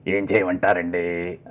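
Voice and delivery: low plaintive film voice, dragged endings; lightly change the pitch, stretching the last words; Kamal Haasan impression. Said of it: "చెప్పినప్పుడు మార్చారు, ఆ pitch మార్చి".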